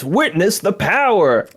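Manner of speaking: slow, high booming boast; booming villain line. Retold or imitated. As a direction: declare it like a villain. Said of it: "his big line in the original is".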